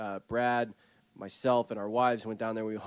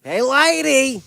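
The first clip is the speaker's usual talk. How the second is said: high, loud nasal nerd voice; mock his nasally voice; nasal nerdy voice; 12 semitones higher, 12 dB louder, much slower than usual. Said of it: "That's exactly how I sound".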